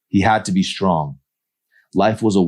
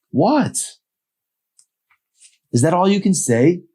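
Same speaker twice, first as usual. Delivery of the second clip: put-on high, louder young woman voice; higher, irritated girl voice; Emery's voice; 14 semitones higher, 4 dB louder than usual. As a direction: voice a fed-up girl calling him back. Emery's voice, annoyed.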